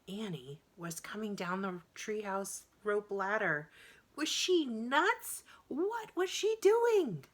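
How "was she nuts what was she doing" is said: high, rising alarmed disbelief; high and louder, shocked. A narrator's reading. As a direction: gasp it out in shock at his sister. Jack alarmed at Annie.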